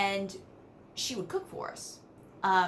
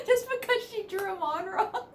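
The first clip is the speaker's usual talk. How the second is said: higher voice, laughing as you say it; 13 semitones higher than usual. Real, not acted. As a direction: crack up over the memory. Laughing at aunt's eyebrows.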